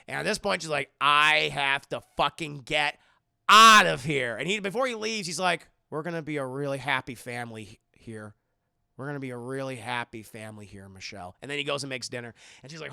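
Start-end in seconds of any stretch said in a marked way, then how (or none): 1.0-4.4 retold resolve bursting loud at the end; emphatic desperation. Captive realizing she must escape, retold with mounting loud desperation. 5.9-11.4 low, quick, trailing into softness; a hint of quiet menace, sinking low; lightly voiced captor. Captor promises her a happy family, lightly acted low menace.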